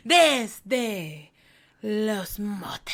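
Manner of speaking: put-on Spanish-accented dramatic announcer read.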